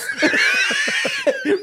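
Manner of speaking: very high, loud howling laugh; real laughter you can't hold in; helpless laughter. Real, not acted. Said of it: "I've hit the deck as well".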